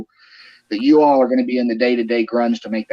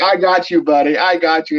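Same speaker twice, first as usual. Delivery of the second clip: slightly higher, playful teasing; retold gleeful gotcha; 5 semitones higher than usual. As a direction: crow over a prank that landed. Retold gloating after the prank.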